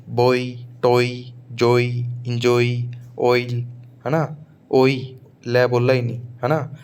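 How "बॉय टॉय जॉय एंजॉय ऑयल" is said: slow drill of बॉय, टॉय, जॉय with the oi sound; teacher drilling oi words. Shown as a demonstration. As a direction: read the oi list out slowly.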